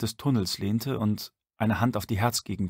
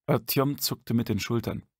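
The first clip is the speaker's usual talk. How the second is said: usual speaking voice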